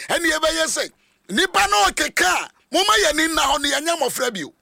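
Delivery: high, forceful sermon shouting; fiery Twi preacher voice; preaching at full force. Acted.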